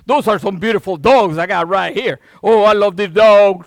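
loud doting praise; shower the dogs with love loudly; gushing to dogs. Retold or imitated. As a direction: owner praising his dogs. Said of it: "I talk to him".

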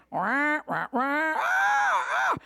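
wah wah then high shrill cries; Peanuts wah wah. Retold or imitated. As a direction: mimic meaningless noise. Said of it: "They were hearing".

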